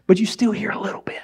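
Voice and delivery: hushed whisper of muffled sound; faint whisper. Shown as a demonstration. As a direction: breathe it like sound through earplugs. Whispers what earplugs let through.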